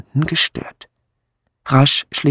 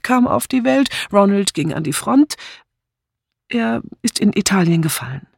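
lightly voiced woman, higher pitched; reluctant young widow; 7 semitones higher than usual. Widow answering a pressing suitor about her dead husband, reluctant. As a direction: recount it with restraint, voice lifted.

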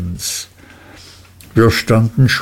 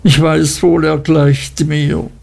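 slow, higher-voiced lament; anguished exclamation; 4 semitones higher, slower than usual. A narrator's reading. Martha likens herself to the river, crying out in anguish. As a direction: exclaim it with drawn-out despair. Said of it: "rief sie aus".

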